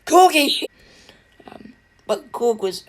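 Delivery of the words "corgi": higher, louder mock call; Thor calling out. Thor calling after his fallen friend Korg, a loud mock call. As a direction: call out loud and high, half-hammed. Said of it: "Thor going".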